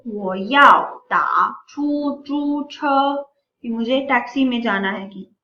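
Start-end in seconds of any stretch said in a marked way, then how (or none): 0.1-2.8 each syllable drawn out slowly; slow teacher's model phrase; slow Chinese demo. Shown as a demonstration. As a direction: sound it out slowly for learners. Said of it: "आप क्या कहेंगे".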